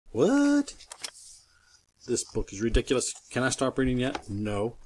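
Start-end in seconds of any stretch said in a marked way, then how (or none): 0.1-0.7 squeaky, much higher what; shocked squeak. Acted. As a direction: jump up in pitch in disbelief. Shocked by the silly song.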